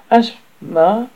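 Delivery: high, loud "Asthma?" with a rise; surprised echo. Acted. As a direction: echo the word back, puzzled. Ralph echoes an odd word.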